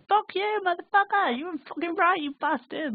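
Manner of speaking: wysoko i głośno, wykrzyczane przekleństwa; shouting curses. Retold or imitated. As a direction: wykrzycz to wysoko, w euforycznym szale. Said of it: "He said that I was shouting at him".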